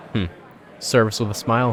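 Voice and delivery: ordinary talk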